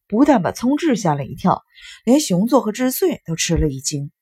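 ordinary delivery

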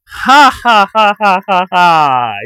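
loud, high, slow ha-ha-ha; gloating storybook guffaw, drawn out; big acted laugh. A narrator's reading. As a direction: laugh out each "ha" loudly, sliding downward. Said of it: "哈哈大笑".

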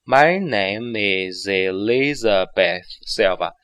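slow, linked sentence; slowed demo. Shown as a demonstration. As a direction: read it slowly with every link. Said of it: "我们放慢一下速度". (放慢速度完整连读)

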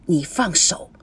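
quiet, controlled displeasure; restrained displeasure. A narrator's reading. Woman to the man grabbing her hand, politely displeased. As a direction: keep it low and composed though annoyed. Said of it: "非常不高兴，但是还保持着风度".

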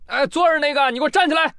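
a tourist shouting at a lazy bear to stand up; mock yell. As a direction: call out sharply to the sitting bear. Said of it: "就大喊了一声".

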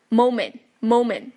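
moment as "momen", t unreleased; dropped final t. Shown as a demonstration. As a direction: leave the t of moment silent. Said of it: "这个t也可以不读出来了".

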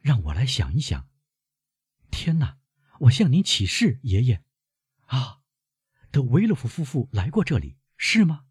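put-on high-pitched young woman questioning her grandfather; concerned girl, pitched well up; worried granddaughter. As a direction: lift the voice high, anxious questions.

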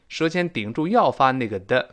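ordinary talk, nothing marked

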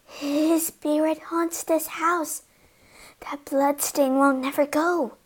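soft, high, fearful tremble; shaking voice. A narrator's reading. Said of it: "voice began to shake".

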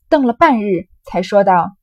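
loud, lively storytelling voice acting out a drinking game; dramatic novel reading. Teacher reads the drinking-game scene aloud, loud and lively. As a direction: read the scene with relish, loud and animated.

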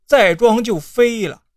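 plain reading voice

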